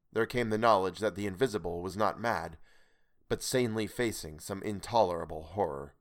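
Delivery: ordinary delivery, nothing marked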